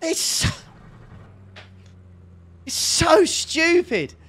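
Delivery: raging at being taken out; angry rant. Real, not acted. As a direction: vent real fury at the crash. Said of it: "I'm so angry".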